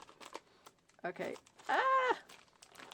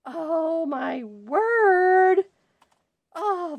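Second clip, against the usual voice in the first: amazed gasp; 7 semitones higher, 8 dB louder, much slower than usual. Real, not acted. Amazed at gift.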